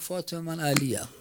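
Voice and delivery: a soft, grief-stricken murmur; hushed grieving words. Acted. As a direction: let «فاطمه، من علیم» fall softly in grief. فقط گفت.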